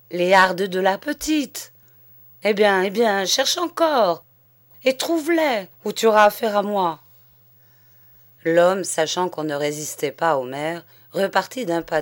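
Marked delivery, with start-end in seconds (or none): 0.1-7.0 rising louder and higher into a furious threat; bully him into searching; the mayor, in tears, turns on his servant with mounting fury; angry threat. A narrator's reading.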